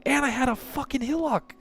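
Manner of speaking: high, exasperated complaining; mad. Real, not acted. Gamer listing the items he lost, high angry exasperation. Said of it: "I'm fucking mad".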